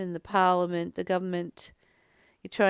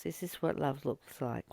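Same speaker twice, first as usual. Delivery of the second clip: saying to yourself; 5 semitones lower, 6 dB quieter than usual. Retold or imitated. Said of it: "we say to ourselves".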